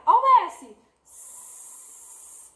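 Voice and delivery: S- held as an unvoiced hiss; hissed S sound. Shown as a demonstration. Teacher to children, hissing the S sound. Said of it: "ó o som do S".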